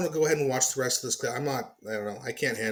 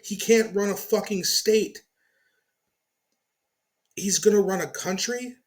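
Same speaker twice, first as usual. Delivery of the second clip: angry rant; 7 semitones higher, 4 dB louder than usual. Real, not acted. Ranting at Newsom clip.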